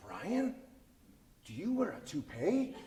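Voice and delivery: a hint of a puzzled older friend asking; serious Bill. Older friend Bill asks Brian, lightly voiced serious question. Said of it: "he gets real serious".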